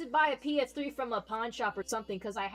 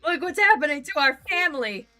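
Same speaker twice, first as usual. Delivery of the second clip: hammed-up louder, higher despair; melodramatic lament; 5 semitones higher, 8 dB louder than usual. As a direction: wail like a soap-opera parent. Melodramatic lament over friend's gaming.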